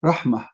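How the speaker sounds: Rahmah said low, ending on a soft breathy H. Shown as a demonstration. Showing viewers there is no T, slow rahmah ending in H. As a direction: drop the pitch and breathe out the H of "Rahmah". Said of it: "It's like letter H".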